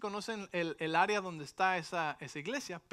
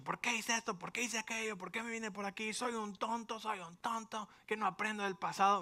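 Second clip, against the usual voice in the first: raised, frustrated self-reproach; self-scolding tantrum; 5 semitones higher than usual. Retold or imitated. Pastor mocking his own panicked self. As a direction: berate yourself like a fool. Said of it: "hubiera dicho".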